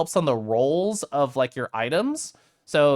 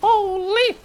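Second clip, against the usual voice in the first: very high, loud delighted squeal; amazed shriek; 5 dB louder than usual. Real, not acted. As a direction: shriek with disbelief. Amazed at loot.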